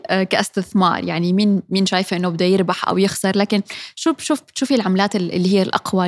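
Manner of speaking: ordinary delivery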